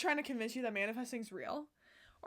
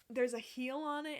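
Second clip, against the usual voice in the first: ordinary delivery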